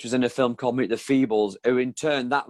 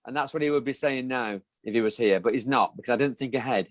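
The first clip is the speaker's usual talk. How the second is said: normal talking voice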